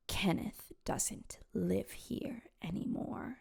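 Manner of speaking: slow, eerie whisper; a woman whispering into a tape recorder. Retold or imitated. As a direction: breathe it out slow and secretive. Sheila's eerie recorded call.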